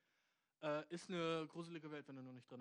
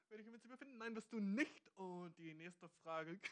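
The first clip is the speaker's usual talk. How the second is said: higher, barely holding back laughter; laughing mock answer; 5 semitones higher than usual. Host jokingly dismisses a listener's question, giggling. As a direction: crack up while brushing off the question.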